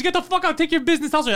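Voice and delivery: high mock angry dismissal; imitated yelling. Shop worker ejecting customer, high imitated yelling. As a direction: send him packing, pitched right up. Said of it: "and they're yelling".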